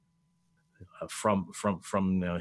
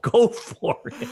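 teasing; 5 dB louder, slower than usual. Real, not acted. Teasing concession, high and slow.

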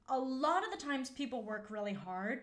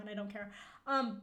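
ordinary talk, nothing marked

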